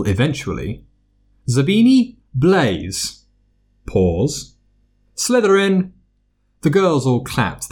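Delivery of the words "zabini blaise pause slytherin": calling names. A narrator's reading. Names called at sorting.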